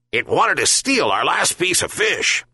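a touch of an indignant pup; yelping dog. A narrator's reading. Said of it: "and Bully yelped".

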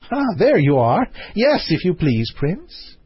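a hint of a playful princess calling up; theme park princess. A narrator's reading. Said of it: "said the princess".

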